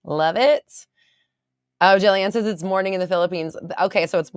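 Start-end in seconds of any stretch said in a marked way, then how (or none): none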